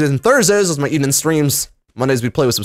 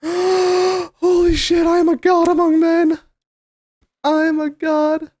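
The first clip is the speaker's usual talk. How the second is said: megalomaniac rant; 12 semitones higher than usual. Real, not acted. Gloating after big haul.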